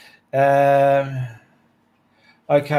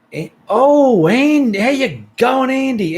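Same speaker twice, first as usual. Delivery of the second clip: much higher, loud delighted hello; excited greeting; 12 semitones higher, 6 dB louder than usual. Real, not acted. Greeting a friend by name.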